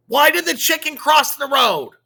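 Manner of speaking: high, loud sudden blurt. A narrator's reading. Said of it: "she blurts out".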